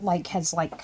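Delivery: ordinary talk, nothing marked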